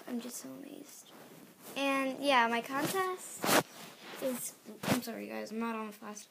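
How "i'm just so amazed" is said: awed breathy whisper. Real, not acted. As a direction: hush it in wonder. Awed whisper about subscribers.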